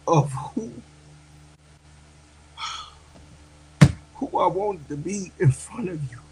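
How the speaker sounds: tearful, heartfelt thanks to the sisters; real crying. Real, not acted. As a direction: push the words out through real tears. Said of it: "Real men cry too".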